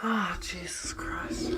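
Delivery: slow, breathy whispered groan; exasperated whisper. Real, not acted. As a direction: whisper it, fed up. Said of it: "quit whispering".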